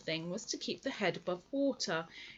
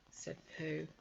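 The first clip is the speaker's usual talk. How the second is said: plain reading voice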